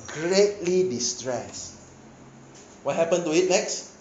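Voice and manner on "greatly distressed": slow and heavy. Shown as a demonstration. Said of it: "Second level".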